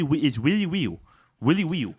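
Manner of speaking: "really real" as "wheely wheel"; "real" said with a w sound; says w for r. Shown as a demonstration. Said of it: "If you can't say your R's".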